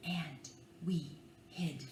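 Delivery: soft hushed whisper. Acted. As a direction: whisper as if hiding. Hiding in the story.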